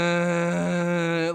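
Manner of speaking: sustained tone with limited power; little breath support. Shown as a demonstration. Singer demonstrating breath while sitting crunched, weakly supported tone. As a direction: hold the note as if crunched over. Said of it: "that's all the breath I got".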